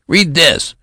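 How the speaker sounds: high, loud desperate yelp; desperate cry. A narrator's reading. Boy general desperately crying out to the colonel, high loud. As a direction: cry out, begging to be taken seriously. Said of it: "cried Benjamin desperately".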